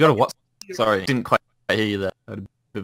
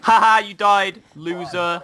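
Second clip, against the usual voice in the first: gloating, slow and high taunt; mocking taunt; 7 semitones higher, 3 dB louder, slower than usual. Real, not acted. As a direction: taunt the loser, drawn out and high. Gloating taunt at dead player.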